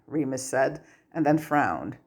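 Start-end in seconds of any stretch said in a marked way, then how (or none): none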